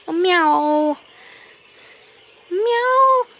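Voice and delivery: high, loud playful meow; mock meow. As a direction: meow at the cat like another cat. Meowing at his cat.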